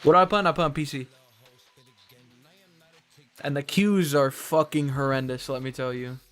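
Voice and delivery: ordinary talk, nothing marked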